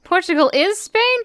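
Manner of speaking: usual speaking voice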